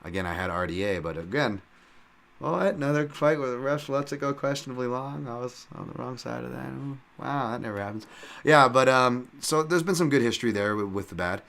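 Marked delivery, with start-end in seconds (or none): 2.4-8.1 high-pitched sarcastic astonishment; mock disbelief. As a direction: feign shock, higher than usual. Mock surprise at a bad stoppage.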